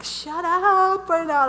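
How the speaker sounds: loud, high, drawn-out scolding; exasperated outburst. Retold or imitated. Retelling telling her chattering angels off, exasperated. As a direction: stretch it out, fed up.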